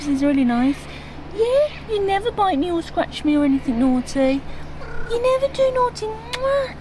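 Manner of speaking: high, loud cooing pet talk. Real, not acted. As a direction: coo over a favourite cat. Talking to the cat.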